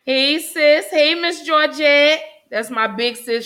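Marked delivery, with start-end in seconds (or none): 0.0-2.2 loud, high and stretched greeting; excited shout-out. Real, not acted. Host greeting her sister in the chat, excited and loud. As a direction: call out to your big sis with delight.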